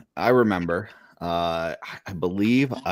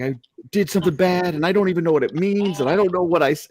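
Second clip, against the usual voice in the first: put-on upset child; 9 semitones higher, faster than usual. Crying child confessing to mom.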